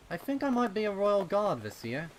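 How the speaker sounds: put-on high, loud boyish voice; young colt voice. Child character voiced in reading.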